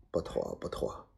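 very low, hushed refusal; demurring. A narrator's reading. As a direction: murmur it doubtfully under the breath. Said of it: "摇着头道". (低声摇头否定)

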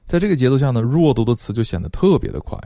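ordinary delivery

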